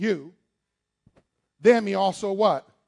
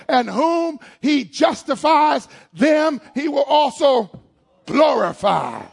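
preaching climax; 6 semitones higher, 7 dB louder, slower than usual. Acted. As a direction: shout it to the rafters like a preacher.

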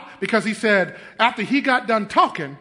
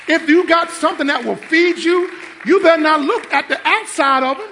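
raised, high preacher's voice; full-force preaching; 9 semitones higher, 8 dB louder than usual. Real, not acted. Pastor warns his church to value the Word, preaching hard. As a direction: drive it home to the congregation, loud.

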